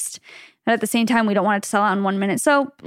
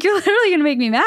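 fast, higher-pitched exasperated retort; mock annoyance; 7 semitones higher, much faster than usual. Podcaster answering Bucks fans' taunts, mock annoyance. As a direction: play up being wound up by rival fans. Retelling irritation at rival fans.

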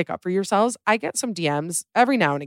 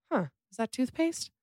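higher, softer, wondering; half-whispered innocent puzzlement; puzzled inner thought; 7 semitones higher, 7 dB quieter than usual. Retold or imitated. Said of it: "I think to myself".

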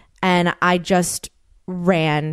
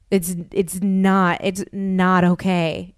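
slow emphatic; slower than usual. Real, not acted. Firm point about online abuse.

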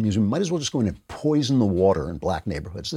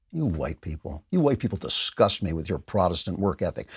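low, hushed mock loathing; quiet, sneering put-on disgust; 5 semitones lower, 6 dB quieter than usual. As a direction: drop low and soft, feigning contempt.

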